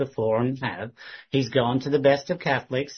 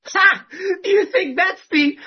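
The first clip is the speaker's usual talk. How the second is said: mocking his loud scoff, much higher and louder; a scornful bark of a laugh, pitched way up; 9 dB louder, slower than usual. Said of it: "he'd do it so much louder".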